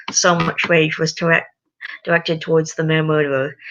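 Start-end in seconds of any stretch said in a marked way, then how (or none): none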